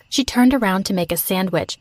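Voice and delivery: ordinary delivery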